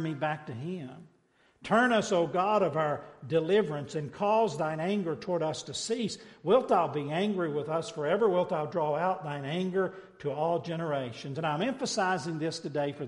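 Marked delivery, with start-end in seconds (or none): 1.6-11.4 loud, raised preaching of the psalm. Acted. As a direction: proclaim it to the back pew. Preaching scripture at full force.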